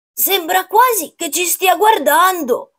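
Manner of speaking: high character voice for a grandpa; grandfather. A narrator's reading. Grandfather remarking on the staring pumpkin, high character voice. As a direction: pitch it well up as grandpa marvels. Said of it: "aggiunse il nonno".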